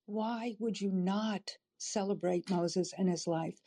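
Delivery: ordinary delivery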